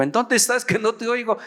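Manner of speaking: high-pitched acted question down the line; straining to hear the caller; puzzled phone query. Calling to someone barely audible. (pregunta aguda, extrañado al teléfono)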